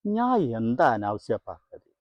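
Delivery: ordinary delivery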